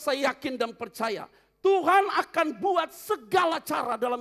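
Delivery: loud, high preacher's exhortation; rally the believers; fiery preaching. Acted. Pastor urging the congregation not to give up, loud and fervent.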